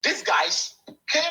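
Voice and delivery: normal talking voice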